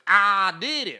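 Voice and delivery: loud, drawn-out Southern drawl; hammed-up Southern accent, belted out. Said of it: "'cause remember he's Southerner".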